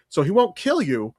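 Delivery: usual speaking voice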